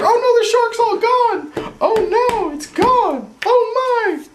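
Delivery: high, slow pretend alarm; mock dismay. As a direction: gasp as if the shark vanished.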